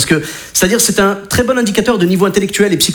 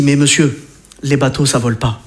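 lower, flat-toned ride operator; a hint of a weary operator; deadpan operator; 7 semitones lower than usual. Ride operator answering a confused dad, lower and dry.

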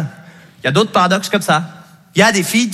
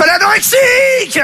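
high, loud mocking cry; shaming the shy girl in front of everyone; mocking shout; 13 semitones higher, 4 dB louder than usual. Moquerie criée en public. (cri moqueur aigu et fort)

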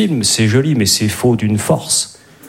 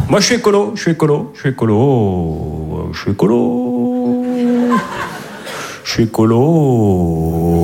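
varied takes of a slogan; slower than usual. Pretend. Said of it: "Il y a des écolos un peu ce soir?".

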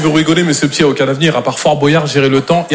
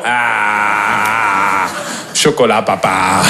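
put-on low, slow goofy kid voice; dopey child saying chocolat papa; a hint of a clueless boy; mock simple kid; 6 semitones lower, much slower than usual. Imitating a kid who licks walls.

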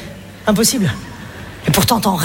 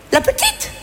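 much higher, surprised little correction; mock correction; slower than usual. A mum correcting another parent about the baby's gender, mock surprise. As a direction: catch yourself on the girl's gender.

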